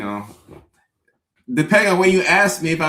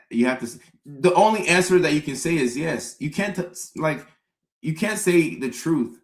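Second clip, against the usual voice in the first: ordinary talk, nothing marked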